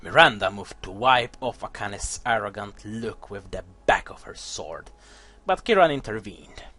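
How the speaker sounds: low, soft dramatic narration, fading to a whisper; hushed storyteller voice; story narration. A narrator's reading. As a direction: read the lore like a dark tale. Reads the game's story text.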